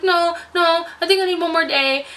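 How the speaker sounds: high-pitched mock protest; retold pleading. Retold plea to doctor.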